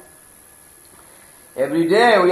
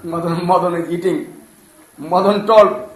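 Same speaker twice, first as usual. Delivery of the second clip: ordinary talk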